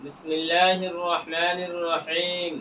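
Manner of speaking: slow, low, measured Arabic invocation; devotional recitation. Acted. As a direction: recite the opening formulas softly, drawing out the long vowels. Opens with Arabic greeting and invocation.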